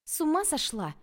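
loud, high, angry snap. A narrator's reading. Angry friend snapping at the narrator. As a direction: snap at her like she's lost her mind. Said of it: "сердито спросила она".

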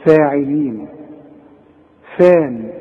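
thin, fronted FEH in "فاعلين"; correct light F. Shown as a demonstration. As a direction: say "فان" with a light, fronted vowel. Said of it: "اقرأ صح ده في القرآن". (فاء مرققة في "فاعلين")

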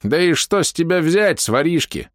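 put-on high, threatening old man. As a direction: snap at him like an armed old man. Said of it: "ткнул дед".

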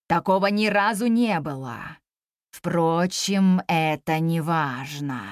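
very low, slow dragon voice; ponderous dragon. Acted. Dragon answering the girl's request, ponderous. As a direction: draw the words out low, like a puzzled old beast.

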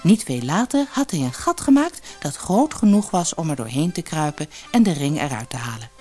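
plain reading voice

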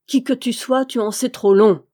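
usual speaking voice

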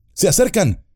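high, urgent warning call; shout. Acted. Legolas warning the company of approaching orcs, high urgent shout. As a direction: cry out that they are coming. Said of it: "gritó Legolas".

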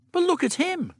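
put-on high, protesting girl's voice; arguing. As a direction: push back, indignant. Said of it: "Dodo argued".

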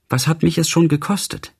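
high-pitched, understated tearful lament. A narrator's reading. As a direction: lift the voice as if near tears. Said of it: "ihr die Tränen in den Augen standen". (den Tränen nahe, mit hoher Stimme)

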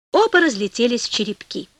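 ordinary delivery, nothing marked

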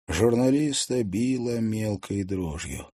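usual speaking voice

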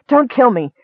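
high, loud pleading cry; terrified scream. A narrator's reading. Hunter screaming at the goblin, high frightened plea. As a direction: beg for his life in panic. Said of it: "the man screamed".

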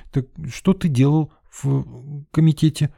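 high, halting question through leftover giggles; slow, broken, still giggly; recovering from laughter. A narrator's reading. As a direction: ask it while getting over a laugh. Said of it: "Отсмеявшись, Лена всё-таки вернулась к теме".

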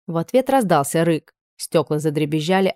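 ordinary delivery

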